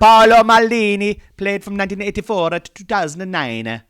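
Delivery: put-on over-the-top Italian accent with the hand gesture. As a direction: sing the dates out like a proud Italian commentator, Italian accent. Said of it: "That is the, uh, Italian accent".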